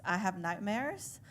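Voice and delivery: normal talking voice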